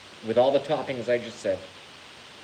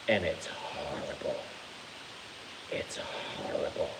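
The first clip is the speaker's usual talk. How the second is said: half-whispered, much softer disgust; disgusted hush; 8 semitones lower, 10 dB quieter than usual. Pretend. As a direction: breathe out the horror of it. Disgust at tomato chowder.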